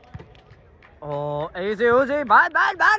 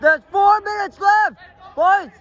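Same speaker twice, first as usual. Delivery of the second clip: calling out; 9 semitones higher, 5 dB louder than usual. Real, not acted. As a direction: shout it across the pitch, higher. Calling to players on field.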